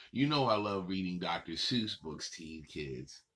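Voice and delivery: plain reading voice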